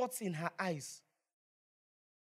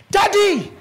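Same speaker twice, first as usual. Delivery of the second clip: loud high call, much higher and louder; shouting for daddy; 12 semitones higher, 18 dB louder than usual. Retold or imitated. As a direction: yell for her father across the house. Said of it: "Then she shouted for her daddy".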